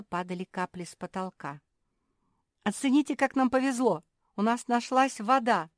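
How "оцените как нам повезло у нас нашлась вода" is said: high, loud cheering; excited announcement. Acted. Mafi showing friends the water, excited. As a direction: proudly sell the good luck.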